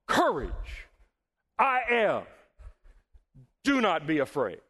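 three separate ringing commands, long pauses between; bold, emphatic preacher's declaration; proclaiming. Acted. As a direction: call each one out over the storm. Preacher re-voices Jesus' three commands.

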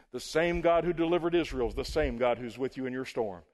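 ordinary delivery

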